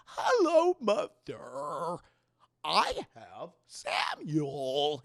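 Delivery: eerie, exaggerated drawn-out greeting; put-on retold figure. Caleb greets his mother with the baby, in an eerie put-on voice.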